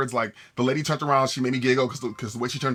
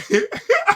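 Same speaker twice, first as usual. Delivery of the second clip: sudden high squealing laugh; high burst of laughter; 6 dB louder than usual. Real, not acted. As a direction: burst out laughing at your own face. Laughs at her own face.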